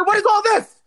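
very high, loud screech; demonstrated screaming. Shown as a demonstration. Showing how they screamed over the charges, outraged screech. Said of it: "how you actually screamed".

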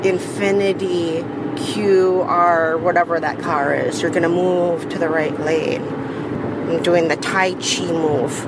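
drawn-out, trance-like command; slow incantation. Real, not acted. Willing a car aside in traffic, slow incantation. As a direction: intone it slowly, as if casting a spell.

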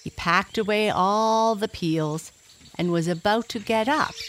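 usual speaking voice

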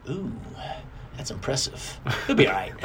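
whispered, dropping low at the end; under-the-breath remark. Retold or imitated. A dad filming his boy's crash, whispering to himself. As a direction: whisper it behind the camera.

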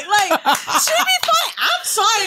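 high, slow, laughing; slow high laugh after the rant. Real, not acted. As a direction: giggle through the apology.

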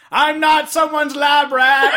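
loud, high, slow movie yell; hammed-up furious shout; imitated outraged shout. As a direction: bellow it like an over-the-top film hero. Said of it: "this scene where he's like".

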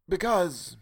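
high, cut-off start; stopping short. A narrator's reading. Stranger starting to explain, stopping short. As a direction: begin and break off. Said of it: "and stopped short".

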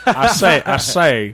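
laughing mock Southern rooster bluster; Foghorn Leghorn. Host imitating the cartoon rooster, loud laughing Southern bluster. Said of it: "Yosemite Sam".

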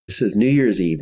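ordinary delivery

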